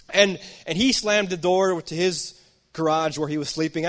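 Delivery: ordinary talk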